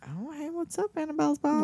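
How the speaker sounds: high, soft put-on boy's voice; kid greeting friend's mom. Boy greets his friend's mom, high soft imitation. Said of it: "he's gotta be thinking".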